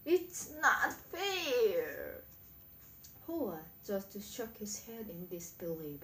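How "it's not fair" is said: high, loud, long-drawn wail of protest; scream at top of voice. A narrator's reading. Ham red-faced over the missing cheese, screaming. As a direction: scream it slowly, stretching each word. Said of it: "he screamed at the top of his voice".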